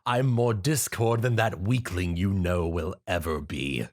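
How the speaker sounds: slow, smug, sinister villain voice; gloating villain. Acted. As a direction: taunt the victim you have tricked. Narrator voices villain impostor.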